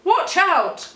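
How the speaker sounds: high, loud warning cry; loud alarmed shout; cried warning. Acted. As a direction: call out to stop them. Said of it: "cried a sensible boxer named Clive".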